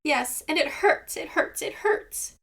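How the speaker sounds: high whine trailing into breath; whining. A narrator's reading. Seagull whining to Raven about his foot, high and breathy. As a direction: whimper it, high and thin. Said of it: "whined Seagull".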